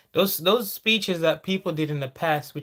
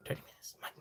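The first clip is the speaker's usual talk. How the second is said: whispered aside; whispered correction to self; 16 dB quieter, faster than usual. Real, not acted. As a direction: drop to a whisper.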